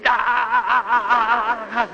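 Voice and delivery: high imitated yell of a screaming boy; boy running in screaming, high imitated yell; mock child scream. Son bursts into dad's phone call, high mock scream. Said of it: "And he's".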